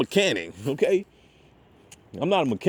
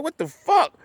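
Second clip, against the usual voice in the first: startled; 11 semitones higher than usual. Retold or imitated. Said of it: "I'm like".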